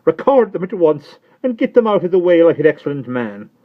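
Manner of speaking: high, loud, mildly weepy; tearful Miss Pross. Acted. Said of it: "still wildly crying".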